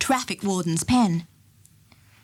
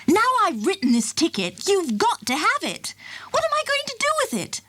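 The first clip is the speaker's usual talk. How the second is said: firm, rising to exasperation; 10 semitones higher than usual. A narrator's reading. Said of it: "very firmly".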